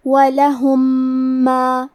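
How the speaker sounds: slow وَلَهُمْ مَا with one doubled, nasal meem; merged meem. Shown as a demonstration. Teacher shows students the merged meem, slow. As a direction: join وَلَهُمْ مَا as a single held, nasal m. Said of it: "ایک ہی میم غنہ اور تشدید کے ساتھ پڑھیں گے".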